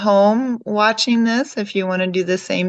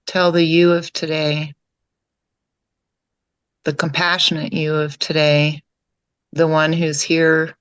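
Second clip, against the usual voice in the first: calm meditation guide, lower than chatting, with long pauses; guided meditation; 4 semitones lower than usual. Real, not acted. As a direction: guide them gently inward, leaving long silences between phrases.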